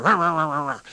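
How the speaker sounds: rallying growl-cheer. Shown as a demonstration. Performer psyching up before going on stage, excited cheering. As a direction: whip yourself up with repeated rahs. Acting out pre-show excitement.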